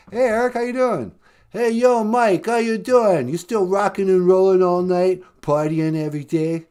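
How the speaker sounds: low put-on rocker voice; rocker caller voice. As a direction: drop low and play a laid-back rocker.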